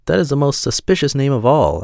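lightly voiced astonished mouse; crying out. A narrator's reading. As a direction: exclaim it with mild alarm. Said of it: "cried the mouse".

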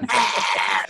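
mock growling "aah"; imitated metal scream. Imitating filthy bands on stage, screaming. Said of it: "are, like".

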